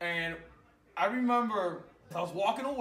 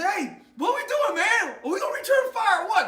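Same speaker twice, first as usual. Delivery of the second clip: much higher, louder eager yell; hyped shout; 10 dB louder than usual. Retold or imitated. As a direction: shout it pumped up for a firefight. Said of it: "I say".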